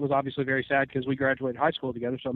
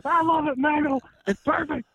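high, put-on announcer voice; pitched-up cowboy commentator; J B L voice again; 11 semitones higher than usual. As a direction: gush in a mock commentator voice. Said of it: "J B L impression".

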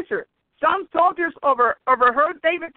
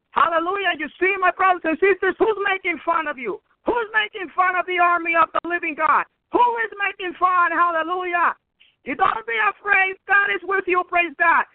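fired-up exhortation; 3 semitones higher than usual. Acted. Preacher rallying the congregation not to fear, fervent. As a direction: lift them up and rally them.

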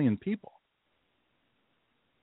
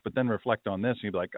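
ordinary delivery

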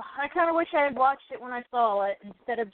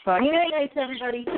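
show sign-off; 4 dB louder, faster than usual. Acted.

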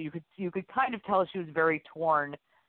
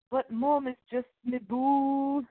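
put-on high, slow Scottish kid voice; Scottish Brave accent; 9 semitones higher, slower than usual. Co-host gives Gideon a high Scottish kid voice again. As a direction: coo it like a wee Scottish boy. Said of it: "any excuse to do a funny voice".

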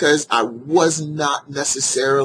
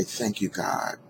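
quiet prayer; 2 semitones lower, 8 dB quieter than usual. Retold or imitated. Whispered relief after crash.

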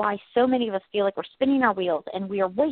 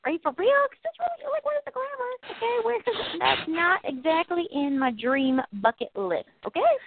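whiny complaint; 6 semitones higher than usual. Retold or imitated. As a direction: whine like a spoiled dreamer. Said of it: "We're like".

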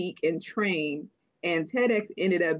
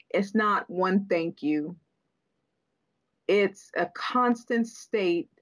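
slow emphatic delivery; slower than usual. Real, not acted. Slow emphatic teaching point.

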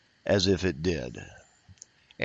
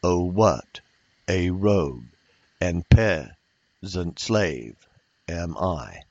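"Oh, what a rogue and peasant slave am I" in slow separated pairs, second syllable stressed; iambic pentameter beat; 3 semitones lower, 3 dB louder, slower than usual. Shown as a demonstration. Teacher quoting Shakespeare to show meter, "Oh, WHAT a ROGUE" in stressed pairs. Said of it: "That's iambic pentameter".

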